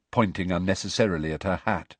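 ordinary delivery

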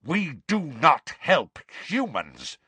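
slow, scornful snarl. Acted. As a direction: snarl each word slowly with contempt. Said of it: "snarled the centaur holding Harry".

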